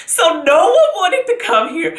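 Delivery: high-pitched, laughing through the words; laughing while talking. Real, not acted. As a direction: laugh helplessly as you complain. Laughing over family refusing to come.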